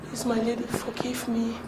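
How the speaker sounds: quiet, humble begging; pleading softly. Acted. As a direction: plead with her, barely above a murmur. Servant begs her mistress.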